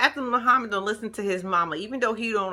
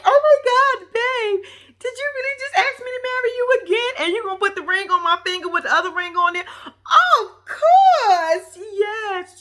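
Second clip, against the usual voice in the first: high, loud, tearful squeal of joy; hammed-up weepy proposal acceptance; shaky happy; 12 semitones higher, 6 dB louder than usual. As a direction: imitate an overjoyed woman saying yes. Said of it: "She was all shaky".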